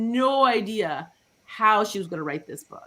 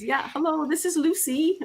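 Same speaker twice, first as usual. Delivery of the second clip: put-on higher-pitched Dutch-accented caller; high, hammed-up Dutch accent; 8 semitones higher than usual. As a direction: imitate an elderly client on the phone with a Dutch accent. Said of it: "That was her Dutch accent".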